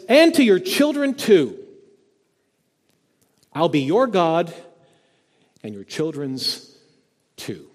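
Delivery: high, loud ringing promise fading to a hush; soaring pulpit proclamation sinking to a near whisper; proclaimed loud. Acted. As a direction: ring out God's promise to the children, then let it drop. Preacher voicing God's promise.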